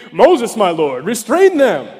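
Moses, my lord, restrain them, said loud and eager; eager young Joshua. Acted. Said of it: "Can you picture this young guy".

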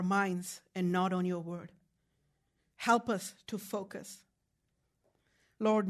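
soft, low, measured prayer voice; leading prayer. Real, not acted. Preacher asking the congregation to bow, praying softly and reverently. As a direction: pray quietly and evenly, pausing between phrases.

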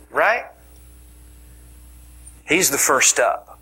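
normal talking voice

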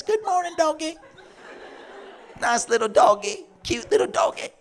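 put-on high, sing-song baby talk to a dog; sweet cooing voice. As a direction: coo at the dog like a pet.

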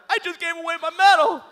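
very high, loud hammed-up despair; mock wail. Runner lamenting the medal he gave away, mock wail. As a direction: bawl it in a comic squeal.

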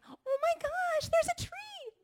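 very high, loud, drawn-out squeal of wonder; Elf. Acted. Wife playing herself as Elf, squealing in childlike delight. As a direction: gush like an overexcited Christmas elf. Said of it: "So Elf and Rambo. I'm like".

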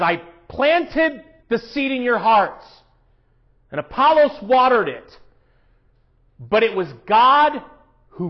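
the pastor driving the point home; full-force preaching. Acted. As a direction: preach it out to the room.

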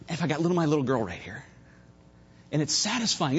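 usual speaking voice

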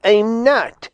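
squabbling skunk's retort; firm denial. A narrator's reading. Skunk denies in quarrel.

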